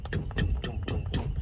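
soft, low thudding footsteps; footstep noises. Retold or imitated. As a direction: mouth the sound of steps, softly. Imitates footsteps to the door.

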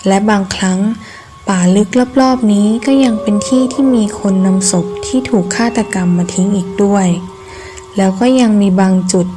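plain reading voice

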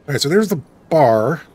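ordinary talk, nothing marked

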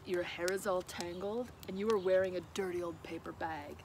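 snooty prince. A narrator's reading. Rude prince scolds princess in story.

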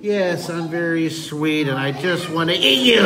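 sweet fish voice erupting into a growl; sweet, then monstrous. Pretend. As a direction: lure sweetly, then pounce on the last words. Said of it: "supposed to be all sweet and".

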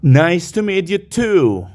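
ordinary talk

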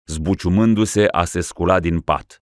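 ordinary delivery

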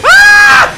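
very high, very loud scream; full panic shriek; panicked scream. Real, not acted. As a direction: shriek as you get ambushed. Ambushed by enemies.

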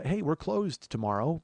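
normal talking voice

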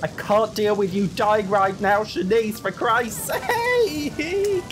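high, pleading, drawn-out last words; exasperated wail. Real, not acted. Player begging her dying Sim, high drawn-out exasperated wail. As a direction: stretch the final sake into a long high wail.

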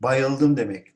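normal talking voice

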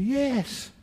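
very high, astonished mock exclamation; amazed yes. Englishman confirms indigestion to Jesus, high amazed yes. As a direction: pitch it right up, amazed to be found out.

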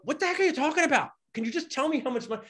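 high, fast baffled outburst; confused exasperation. Retold or imitated. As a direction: throw up your hands at the jargon. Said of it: "My accountant confuses me all the time".

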